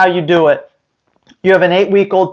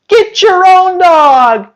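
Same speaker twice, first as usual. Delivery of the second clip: loud, slow and high, fed up; indignant outburst; 9 semitones higher, 4 dB louder, slower than usual. Acted. Trainer scolding a stranger who reaches for his dog, loud and indignant. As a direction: snap at the stranger to get their own dog.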